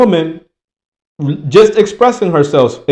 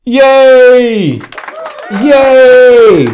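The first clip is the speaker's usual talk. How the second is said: high, loud cartoonish cheer; sarcastic crowd yay; mock cheering masses; 7 semitones higher, 5 dB louder than usual. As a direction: ham up a dumb crowd cheering. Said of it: "what do you hear the masses doing".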